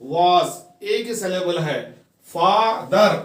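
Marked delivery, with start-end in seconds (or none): 2.3-3.1 "father" split into two slow beats; syllable by syllable. Shown as a demonstration. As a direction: say "father" as fa, then dar. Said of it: "इसके दो syllable है".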